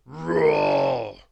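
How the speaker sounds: loud, long bear roar; the bear roaring at Little Miss Bossy. Acted. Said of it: "roared the bear".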